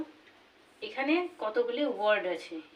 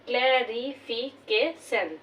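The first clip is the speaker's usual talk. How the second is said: Clarification slowly, cla-ri-fi-ke-shan in five beats; five-syllable breakdown; much slower than usual. Shown as a demonstration. Teacher counting five syllables, clarification slowly split as cla-ri-fi-ke-shan. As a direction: give each syllable of clarification its own beat, cla, ri, fi, ke, shan. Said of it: "এটি আমরা পাঁচবার".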